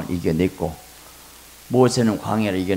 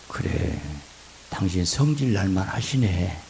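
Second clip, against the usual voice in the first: quiet, gentle agreeing; calm him down softly; soft soothing wife; 2 semitones lower, 7 dB quieter than usual. Retold or imitated. Wife softly soothing her angry husband.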